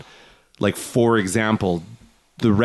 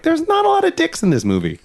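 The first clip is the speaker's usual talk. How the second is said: high mock-incredulous thought; inner thought. Watching with family, mock-puzzled high inner voice. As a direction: voice his disbelieving thought lightly. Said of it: "I'm like".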